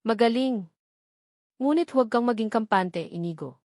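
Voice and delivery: lightly voiced, crisp and cutting; a hint of a sharp-tongued grandmother, clipped praise then warning; sharp as crystal. A narrator's reading. Grandmother praising then warning Inigo, crisp and cutting. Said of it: "isang boses na kasing talas ng pinong kristal".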